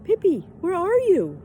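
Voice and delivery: high, loud anxious call; worried cry. A narrator's reading. Tommy calling for vanished Pippi, high worried cry. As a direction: call out for a friend who vanished. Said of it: "he cried, worried".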